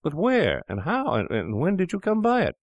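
high, stammering disbelief; shocked cry. Acted. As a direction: sputter it out in shock. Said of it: "cried the other".

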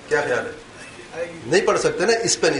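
usual speaking voice

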